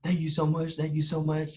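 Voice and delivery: politely contained excitement; composed thanks. Retold or imitated. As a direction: thank them while holding excitement in. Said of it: "keep my composure".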